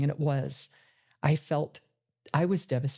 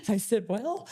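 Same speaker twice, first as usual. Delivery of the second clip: real laugh through the words, much higher; 13 semitones higher than usual. Real, not acted. Mother recalling her reply to her daughter, laughing. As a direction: let the words ride up on a laugh.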